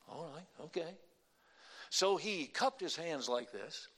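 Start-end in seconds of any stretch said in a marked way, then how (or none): none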